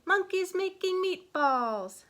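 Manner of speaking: high, loud, slow call-out; hammed-up catchphrase, stretched out; exclaiming. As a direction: belt it like a silly slogan. Mock exclamation within narration.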